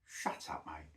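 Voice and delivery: hushed exasperated put-down; whispered at a post he reads; whispered retort. Retold or imitated. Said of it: "I see some of these posts and I think".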